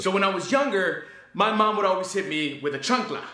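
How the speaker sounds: put-on stand-up stage voice; Carlos Mencia impression. Reviewer performs his written joke to an audience, mock stand-up delivery. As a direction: work the room with a childhood joke setup. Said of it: "my best attempt at Mencia".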